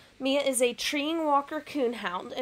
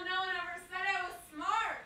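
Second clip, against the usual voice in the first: high, softer self-mockery; self-mocking exclamation; 6 semitones higher, 7 dB quieter than usual. Real, not acted. Streamer laughing off hitting a wall, high self-mocking exclamation. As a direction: joke at your own expense, pitched up. First take, before accented repeat.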